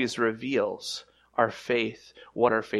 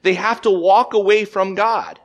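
loud preaching; 8 semitones higher, 8 dB louder than usual. Acted. Preaching climax.

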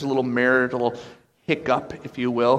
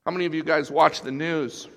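normal talking voice

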